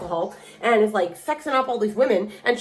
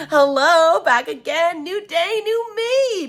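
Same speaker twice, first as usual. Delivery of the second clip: high, loud singsong hello; exaggerated greeting; 9 semitones higher, 4 dB louder, much slower than usual. Reader returns to the camera, exaggerated singsong greeting. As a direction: climb higher with each phrase.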